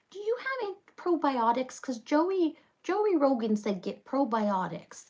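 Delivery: put-on high-pitched old-man voice; mock old man asking at a counter; imitated elderly customer. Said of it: "probably eighty years old".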